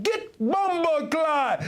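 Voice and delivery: slow, high mock Jamaican patois; Jamaican accent. Mocking how Jamaicans would answer him, slow high Jamaican accent. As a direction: drag it out like an outraged Jamaican local. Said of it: "they'd be like".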